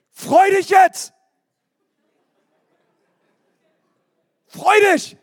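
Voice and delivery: comic shouted order to cheer up; mock order to be happy. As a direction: demand joy on command, hammed up.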